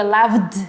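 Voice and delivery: "loved" as one syllable ending in a buzzing v-d; "loved" with a marked voiced d. Shown as a demonstration. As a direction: release the final d of "loved" audibly. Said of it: "sí vibra".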